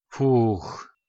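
a short groan of disgust after vomiting; sick prisoner groans and collapses onto the floor; nauseous groan. Acted. Шамшуддин groans weakly after throwing up. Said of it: "простонал он".

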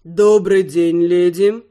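low, slow menacing baritone drawl; sinister drawl. Acted. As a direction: draw it out, low and ominous. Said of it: "зловеще протянул красивый мужской баритон".

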